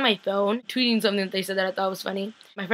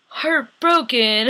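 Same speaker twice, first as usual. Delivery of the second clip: slow, higher, hammed-up sorrow; mock heartbroken; 4 semitones higher, 5 dB louder, much slower than usual. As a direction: stretch it out like a fake wounded sigh. Actor's mock sad one-word reply.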